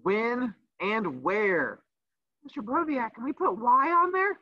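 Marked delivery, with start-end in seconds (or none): 2.4-4.4 high, whiny student asking; teacher mimicking a student's high whiny question; mock student voice. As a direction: play the pesky kid raising a question.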